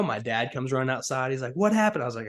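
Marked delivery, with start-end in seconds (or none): 1.5-2.0 alarmed dad. Retold or imitated.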